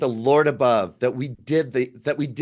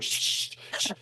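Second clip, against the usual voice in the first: whispered shh, hushing her quickly; shushing; 9 dB quieter than usual. Retold or imitated. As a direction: breathe out a quick hush to quiet her. Hushing his wife mid-conversation.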